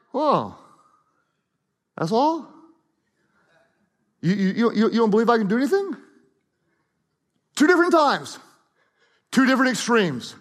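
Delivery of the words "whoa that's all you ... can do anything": disappointed. Shown as a demonstration. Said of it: "he's amazed like".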